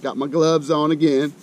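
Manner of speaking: ordinary talk, nothing marked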